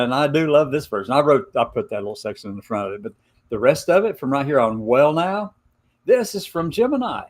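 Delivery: ordinary talk, nothing marked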